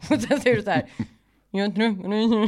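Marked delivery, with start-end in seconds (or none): 1.5-2.4 mumbled through clenched teeth, laughing; talking through teeth. Retold or imitated. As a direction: barely open the lips. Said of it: "prata genom mina tänder". (mumlat genom tänderna)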